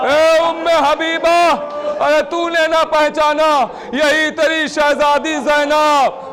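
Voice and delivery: slow drawn-out majlis wail; slow lament. Acted. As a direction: draw each word out in grief. Lament continues, slowed.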